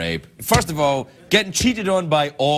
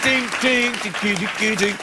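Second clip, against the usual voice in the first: mock chime; 8 semitones higher, much slower than usual. Imitating email chime.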